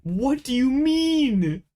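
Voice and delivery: high, loud, slow incredulous wail; a drawn-out cry of protest; outraged disbelief. Real, not acted. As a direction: stretch it out in real disbelief. Disbelief at opponent's luck.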